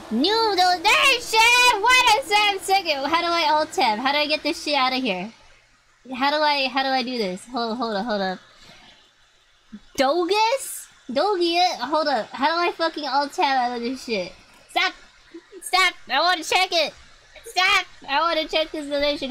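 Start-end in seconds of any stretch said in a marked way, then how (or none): 0.1-3.0 excited donation shout. Real, not acted. Donation alert on stream. 14.7-19.3 panicked shouting. Real, not acted. Panicking, can't alt-tab.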